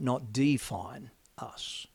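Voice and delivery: slow hushed whisper; slow whispered punchline. Acted. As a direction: whisper the point home. Sermon's key line.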